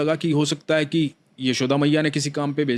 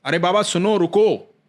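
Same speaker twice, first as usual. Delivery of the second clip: put-on child calls out to the old man, raised and higher; calling out; 5 semitones higher, 4 dB louder than usual. A narrator's reading. Boy calls out to the angry old devotee, raised voice. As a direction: call after him across the herd. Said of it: "एक बालक आवाज दे रहा है".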